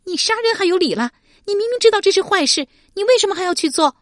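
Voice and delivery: high, loud, fast furious shout. Acted. Shi Pei furious at Deng Yi's excuses, shouting. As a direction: explode at him in outrage. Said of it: "勃然大怒道".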